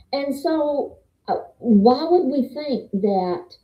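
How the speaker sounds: normal talking voice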